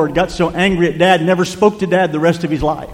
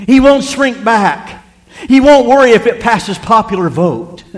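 slow, louder, higher proclamation; declaring preaching; 5 semitones higher, 3 dB louder, slower than usual. Acted. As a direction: proclaim it slowly and firmly. Voices God's statement, preaching.

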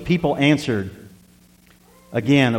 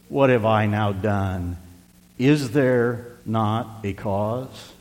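drawn out and softer, each word weighed; slow, soft plea; 5 semitones lower, 6 dB quieter, much slower than usual. Retold or imitated. David answering his brother, slow and soft. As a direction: let the question hang slowly.

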